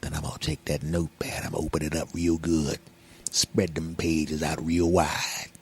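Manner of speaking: low, soft, suggestive voice; hushed, deep mock come-on; mock-seductive alter ego. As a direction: tease it out low and quiet. Sultry alter-ego talk continues.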